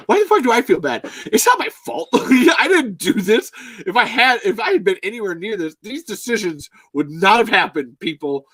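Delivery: high, loud, worked-up denial; exasperated protest. Real, not acted. Host ranting that he is not to blame, exasperated. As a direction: protest loudly, pitch jumping up.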